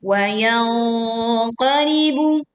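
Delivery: "wayang qalibu" chanted with thick open hum; correct veiled nun. Shown as a demonstration. Teacher giving the correct reading, veiled nasal chant. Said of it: "Yang betul adalah".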